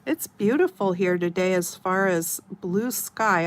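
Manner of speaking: ordinary talk, nothing marked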